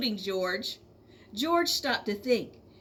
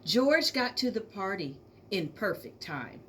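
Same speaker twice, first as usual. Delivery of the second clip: ordinary delivery, nothing marked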